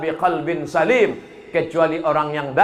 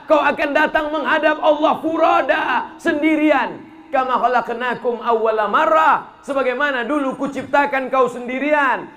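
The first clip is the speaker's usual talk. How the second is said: forceful preaching; 6 semitones higher than usual. Acted. Preaching Quran verse forcefully.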